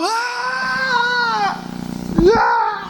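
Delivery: high cry of grief, shouted out; a sudden wail of grief; loud crying wail. Retold or imitated. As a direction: let out a wailing cry. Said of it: "Ele dá um gritão".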